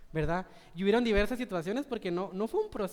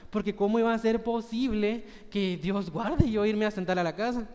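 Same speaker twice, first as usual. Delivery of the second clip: ordinary talk, nothing marked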